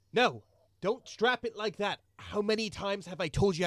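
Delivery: high, loud exasperated scold. Acted. Merchant scolding a dwarf's packing, loud. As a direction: snap at a careless worker.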